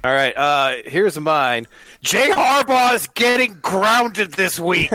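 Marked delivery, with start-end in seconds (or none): 2.0-4.9 high, slow, hammed-up proclamation; mock hot take. Giving co-hosts his own hot take, hammed-up proclamation. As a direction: declare it high and drawn out, playing it up.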